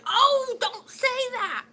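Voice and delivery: high, loud prim gasp of shock; mock shocked lady. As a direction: play the scandalised friend. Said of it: "she'll be sitting there going".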